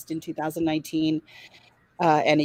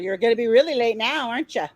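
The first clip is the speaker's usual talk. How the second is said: mock officer; 6 semitones higher, 4 dB louder than usual. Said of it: "He's like".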